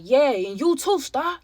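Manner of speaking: lightly voiced second intruder calling out Star. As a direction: taunt her by name from across the room. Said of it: "a completely whole different voice".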